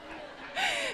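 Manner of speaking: a quick, soft, high laugh; short laughter falling down. Real, not acted. Laughs after "Pero.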